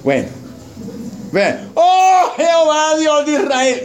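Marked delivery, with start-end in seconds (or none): 1.8-3.8 high, slow theatrical wail; exaggerated tearful cry to heaven; mock wailing prayer. Pastor replays Israel's prayer to God, mock wailing. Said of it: "estaban llorando".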